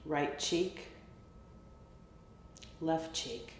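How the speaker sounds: soft, unhurried meditation voice with long pauses; guided body scan. Real, not acted. Therapist guiding listeners through a body scan, soft meditation voice. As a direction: softly call out each body part, leaving space after it.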